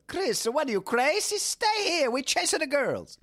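high-pitched hammed-up Italian accent; bad Italian accent. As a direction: plead in a cartoonish Italian accent. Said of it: "worst impression of an Italian accent".

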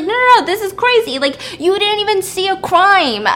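high, loud acted outburst; exasperated protest. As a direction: protest in disbelief, pitch leaping way up.

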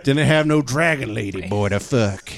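put-on old Eastwood grumble. Eastwood riff continues.

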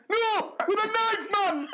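high slow Geordie correction; Geordie punchline. Retold or imitated. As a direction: spell it out slowly like it's obvious, man.

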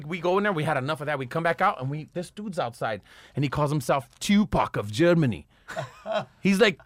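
ordinary talk, nothing marked

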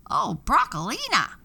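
high, loud squawking parrot voice; high panicked parrot cry; frightened parrot cry. A narrator's reading. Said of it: "the parrot cried".

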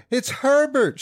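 high, loud frantic scream. A narrator's reading. Wife realises her dead son is knocking, screaming. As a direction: scream it out at the top of the voice. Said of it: "she screamed".

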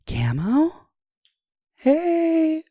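a fan shrieking her name happily; excited fan call. Retold or imitated.

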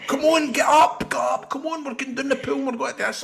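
high, urgent chivvying voice; rousing someone. Retold or imitated. Family rousing a hungover man, high insistent chivvying. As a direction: imitate pushy relatives getting someone up.

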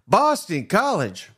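Boston College drawn out slow and high; incredulous. Real, not acted. Host stunned at Boston College outbidding them.

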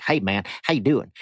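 bad Texan politician impersonation; badly faked Texas twang; George Bush. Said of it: "a really bad George Bush impersonation".

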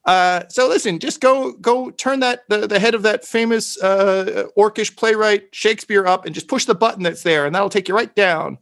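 high-pitched fussy NPC giving secret directions; squeaky shopkeeper. Acted. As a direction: squeak it out like a nervous little shopkeeper. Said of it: "He's like".

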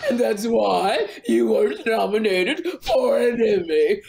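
slow, drawn-out cartoon character. Comedian explaining the Emmy nomination to her co-host, cartoon voice. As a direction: stretch every word in a hammed-up cartoon voice.

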